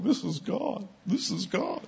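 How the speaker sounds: high, soft tearful squeal; mock tearful. Healed woman crying at the checkout, mock tearful. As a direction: imitate a woman crying in high-pitched awe. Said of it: "she's crying".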